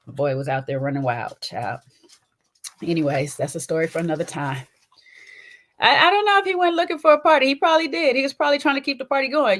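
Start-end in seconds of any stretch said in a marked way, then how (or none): 5.8-9.6 high and fast, hyped up; excited. Real, not acted. Owner picturing her runaway dog partying, high and hyped. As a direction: rattle it off, hyped.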